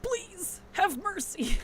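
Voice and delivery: high, pleading, hammed-up cry for mercy; mock begging. Telling chat how she begs her chin cramp, in high mock pleading. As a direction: beg the cramp to spare you, half-joking.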